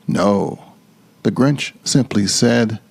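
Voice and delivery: ordinary delivery, nothing marked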